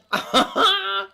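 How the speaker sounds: a loud, high burst of laughter; delighted real laugh. Real, not acted. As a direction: break into happy laughter. Laughs at a comment.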